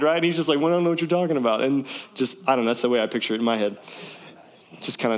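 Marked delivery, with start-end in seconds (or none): 0.6-1.6 rapid, breezy brush-off; evasive Saul. Retold or imitated. Saul brushing off Samuel's question, quick and evasive. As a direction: rattle it off to dodge blame.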